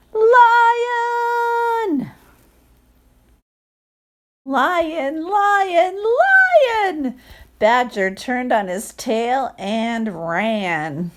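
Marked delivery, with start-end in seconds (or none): 0.1-7.2 high, loud panicked shout; panicked cry. Acted. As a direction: yell it out in terror. Badger screams in panic.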